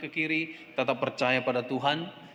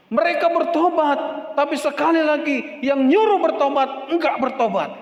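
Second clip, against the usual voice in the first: seruan keras; 13 semitones higher, 8 dB louder than usual. Real, not acted.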